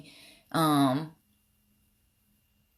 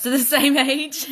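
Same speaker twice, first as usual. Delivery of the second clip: laughing through it, higher; 8 semitones higher, 5 dB louder than usual. Real, not acted. Vlogger answering a friend's comment about age, laughing. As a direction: tease a friend while giggling.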